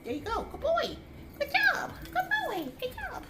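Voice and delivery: very high, squeaky cheering for a dog; squeaky pet praise. Real, not acted. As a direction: praise a pup in a tiny falsetto.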